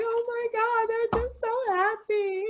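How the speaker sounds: very high, squeaky tearful voice; hammed-up weepy wail through laughter; mock crying. Acting out sobbing over a happy commercial, mock crying. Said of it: "you just start crying".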